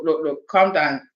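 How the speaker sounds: ordinary talk, nothing marked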